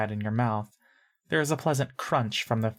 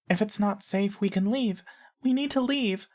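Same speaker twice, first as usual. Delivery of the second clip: put-on much higher little-girl voice; high-pitched girl; 9 semitones higher than usual. A narrator's reading. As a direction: ask it in a high, girlish pitch. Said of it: "and high-pitched".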